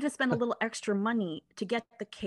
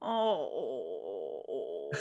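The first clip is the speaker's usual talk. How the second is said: long low sigh deflating into a sob; loud disappointed sigh; 6 dB quieter than usual. Retold or imitated. Driver sighing over missing cookies, deflated groan. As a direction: let all the hope drain out. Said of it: "I kinda sighed really loud".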